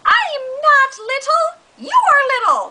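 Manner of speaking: shrill cat snapping back at the dog; cat squeaking back. Acted. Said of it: "the dog and the cat".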